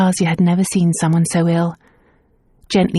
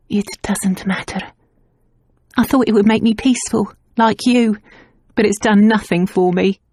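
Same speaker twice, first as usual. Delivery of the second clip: tearful voice; 4 semitones higher than usual. Acted. Crying maid.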